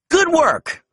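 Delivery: high, bright cheer. A narrator's reading. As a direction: cheer the pup on. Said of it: "Ryder cheered".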